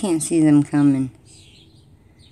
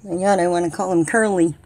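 ordinary talk, nothing marked

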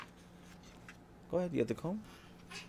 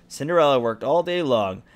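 usual speaking voice

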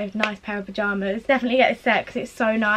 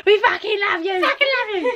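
higher and higher, building with delight; excited gush; 9 semitones higher, faster than usual. Real, not acted. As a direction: gush it out, climbing in pitch. Shouting love to a friend.

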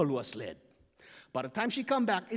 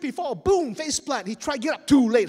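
ordinary delivery, nothing marked

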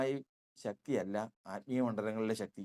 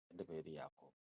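low, much softer admission; humble confession; 9 semitones lower, 18 dB quieter than usual. Acted. Jacob humbly tells the angel his true name, low and soft. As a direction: say your name as a broken confession.

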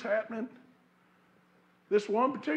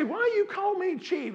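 high-pitched, pouting complaint; higher, mock-indignant pout; pouty protest; 10 semitones higher, 5 dB louder than usual. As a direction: imitate an old lady protesting with a pout. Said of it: "she'd pooch her lips out".

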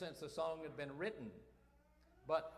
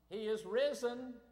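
answering call; 6 semitones higher, 5 dB louder than usual. Retold or imitated. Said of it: "the other person responds".

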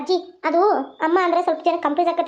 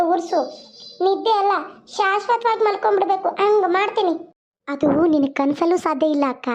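usual speaking voice

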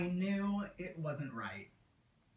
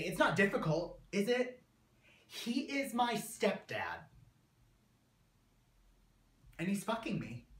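angry; 4 semitones higher than usual. Acted. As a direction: raise the pitch and yell it in fury. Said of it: "I have this anger".

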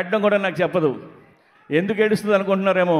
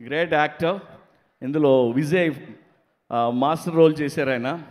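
ordinary talk

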